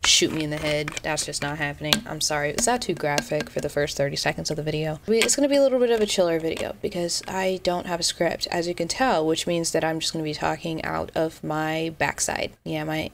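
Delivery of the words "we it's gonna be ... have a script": normal talking voice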